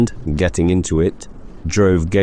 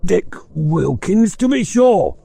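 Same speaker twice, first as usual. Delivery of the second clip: put-on higher, fond old-man voice; old Scrooge fond; 13 semitones higher than usual. Scrooge telling the ghost, fond and higher. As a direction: recall an old friend fondly. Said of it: "said Scrooge to the ghost".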